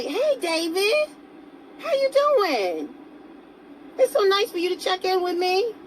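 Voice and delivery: drip with put-on sweetness; sugary greeting. Mocking a needy person greeting her man in high sugary tones.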